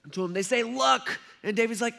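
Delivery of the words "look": usual speaking voice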